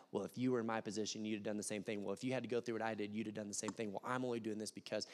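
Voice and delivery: self-justifying excuses. Pretend. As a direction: talk yourself into being right. Self-justifying believer mutters excuses.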